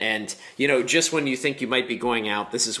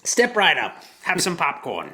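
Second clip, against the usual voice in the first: carnival barker; 7 semitones higher than usual. Acted. Echoes barker, circus theme.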